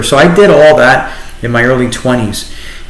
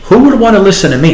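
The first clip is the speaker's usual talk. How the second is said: raised, fast and disbelieving, almost laughing; retelling a doubting inner thought, half laughing; incredulous self-doubt; 8 semitones higher, much faster than usual. Retold or imitated. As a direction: ask it as if the idea is absurd. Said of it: "I thought to myself".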